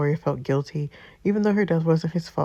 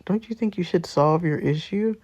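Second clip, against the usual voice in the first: normal talking voice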